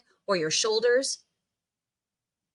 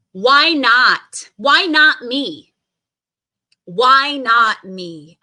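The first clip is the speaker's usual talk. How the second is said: loud, slow defiant shout; 9 dB louder, much slower than usual. Shown as a demonstration. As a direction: shout it like you mean it. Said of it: "affirmation shouted".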